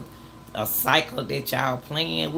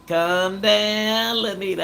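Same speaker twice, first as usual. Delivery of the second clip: higher, stretched-out "calm down" imitation; long, raised mock soothing; drawn-out calming call; 6 semitones higher, 4 dB louder, slower than usual. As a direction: imitate someone dragging out a plea to settle down. Mimics others telling her to calm down.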